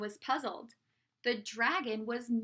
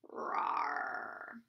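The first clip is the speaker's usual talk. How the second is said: low, voiceless growled roar; softer rasp of a roar, drawn out; dragon roar; 6 dB quieter than usual. A narrator's reading. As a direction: roar on the breath like a distant dragon. Narrator voices the dragon in battle.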